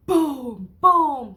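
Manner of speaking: loud, falling double boom; thunder booms. Shown as a demonstration. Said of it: "can make the thunder sound".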